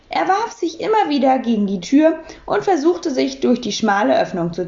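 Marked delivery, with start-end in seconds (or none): none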